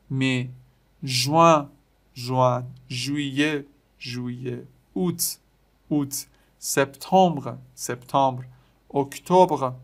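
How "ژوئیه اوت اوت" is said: usual speaking voice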